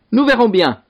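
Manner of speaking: high, forceful lordly threat; contained yell from an angry lord; storybook shout. A narrator's reading. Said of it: "hurla le seigneur".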